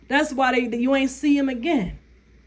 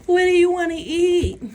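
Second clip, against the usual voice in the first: higher, louder indecisive man; mock whiny man; 6 semitones higher, 4 dB louder than usual. Mocking a clueless man asking his date, high and loud. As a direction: mimic the clueless boyfriend.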